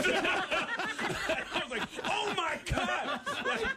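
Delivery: high laughing through the words; cracking up while saying it; real laughter, squeezed high. Real, not acted.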